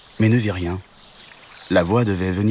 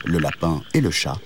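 plain reading voice

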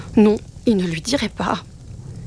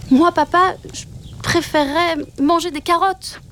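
petit loup timide; 8 semitones higher than usual. Acted. Louveteau avoue préférer les carottes.

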